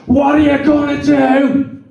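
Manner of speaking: high, slow roared question; imitated roar. God roars into falling Alan's ear, imitated roar. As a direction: roar it slowly as God would, pitched high. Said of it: "You roared at me".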